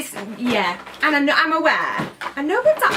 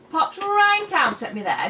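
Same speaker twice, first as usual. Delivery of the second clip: ordinary talk, nothing marked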